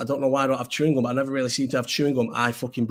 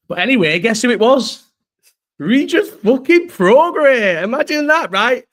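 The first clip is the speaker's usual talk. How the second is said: much higher and louder, slow and stretched on the name; excited reveal; 9 semitones higher, 10 dB louder, slower than usual. Real, not acted. Telling his mate who messaged him, thrilled. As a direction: shout the name out in disbelief.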